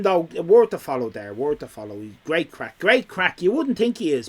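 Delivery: usual speaking voice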